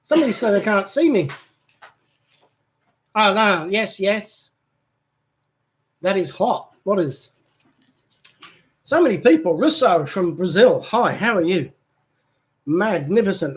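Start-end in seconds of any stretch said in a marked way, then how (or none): none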